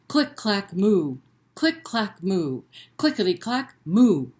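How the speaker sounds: rhythmic click clack with a big loud moo; read-aloud refrain; typing cow refrain. Acted. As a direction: bounce the clicks, belt the moo. Refrain again.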